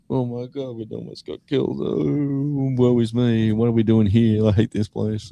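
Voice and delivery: high, put-on sensitive mate's lament; mock distressed wailing. Sensitive bandmates curled up on the lounge, mock distressed wailing. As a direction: wail it in a hammed-up woeful voice. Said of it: "two sensitive ones were, like I said, curled up".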